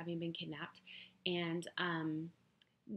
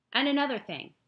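insistent; 9 semitones higher, 11 dB louder than usual. Acted. Heroine piles on complaints.

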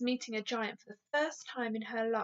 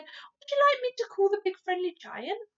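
put-on higher, eager little-girl voice; a bright child offering to help; little girl Sophie; 9 semitones higher, 5 dB louder, faster than usual. Sophie's voice in story reading.

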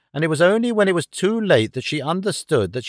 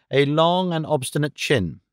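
usual speaking voice

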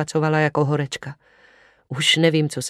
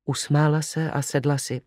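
plain reading voice

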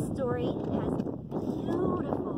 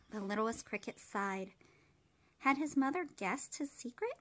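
put-on gentle, even read-aloud voice for children; storybook reading; 2 semitones lower, 4 dB quieter than usual. A narrator's reading. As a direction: read it like bedtime story time. Reads picture book aloud.

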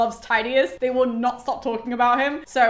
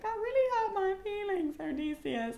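hurt feelings; 9 semitones higher, 7 dB quieter than usual. Retold or imitated. Agamemnon wounded by Odysseus.

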